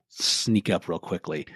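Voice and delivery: normal talking voice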